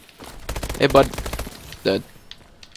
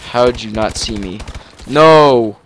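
normal talking voice

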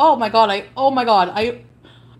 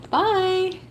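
mocking bye; 8 semitones higher than usual. Real, not acted. Mocking goodbye after blocking.

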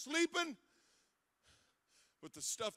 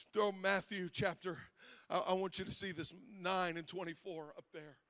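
ordinary talk

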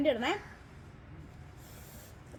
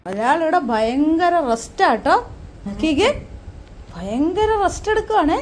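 teasing call; 3 semitones higher, 12 dB louder than usual. Real, not acted. Teasing husband resting, calling loudly.

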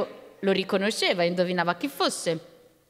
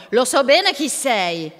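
loud, slow, emphatic retort; old man's raised-voice accusation; angry shout; 3 semitones higher, 6 dB louder, slower than usual. A narrator's reading. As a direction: call it out defiantly, drawing it out. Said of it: "gli gridò".

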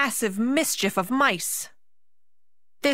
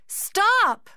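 shout; 14 semitones higher, 5 dB louder than usual. A narrator's reading. Marie stopping rampage.

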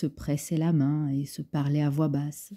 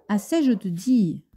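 raised, impatient order to stop; sharp scolding; 5 semitones higher, 3 dB louder than usual. Acted. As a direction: cut the child off firmly. Maid scolding splashing child.

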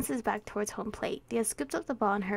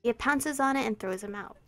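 ordinary talk, nothing marked

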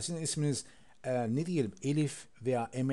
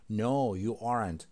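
slow; 3 semitones lower, much slower than usual. Shown as a demonstration. Slow model answer.

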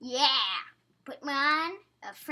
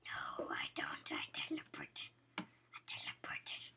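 hushed, airy whisper; 3 dB quieter than usual. Pretend. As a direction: whisper it under your breath. Voiced item escaping, whispering.

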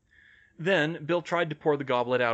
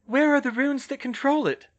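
high, loud question; high female character voice, calling; Hermione's voice; 14 semitones higher, 7 dB louder than usual. A narrator's reading. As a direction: pitch it high and project. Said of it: "she asked".

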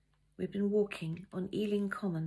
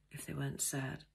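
ordinary delivery, nothing marked

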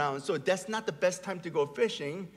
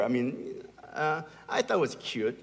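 ordinary delivery

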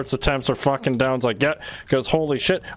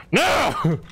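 much higher, desperate yelling; frantic real shouting; 12 semitones higher, slower than usual. Real, not acted. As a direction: scream at teammates who won't help. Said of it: "Don't you hear me screaming".

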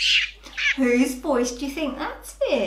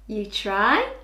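ordinary talk, nothing marked

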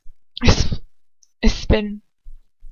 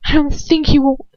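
defiant taunt; 7 semitones higher than usual. Acted. Captive defies attacker.

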